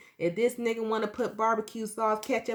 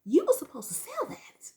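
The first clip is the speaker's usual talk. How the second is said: high, louder scolding; angry retort; 12 semitones higher, 3 dB louder than usual. Retold or imitated. Scolding her boyfriend, high and sharp. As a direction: snap at him for flushing it. Yelling at boyfriend over cookie.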